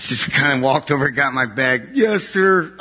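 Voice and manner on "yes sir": high, crisp military yes sir; obedient son. Retold or imitated. Answering his stroke-stricken dad, a quick high obedient reply. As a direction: snap to it like a soldier. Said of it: "obeying his father".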